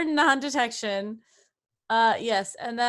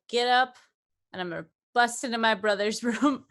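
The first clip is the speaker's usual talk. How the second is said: ordinary talk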